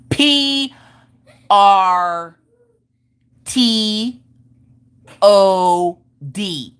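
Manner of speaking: loud, drawn-out single letters; cocky spelling. Retold or imitated. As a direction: announce each letter with a long gap after it. Kid confidently calls out wrong letters.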